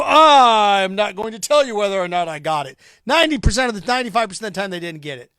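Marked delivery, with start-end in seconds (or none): 0.0-2.8 louder mocking voice of a defiant fan; mock stubborn refuser. Host mocking people who hide vaccine status, loud.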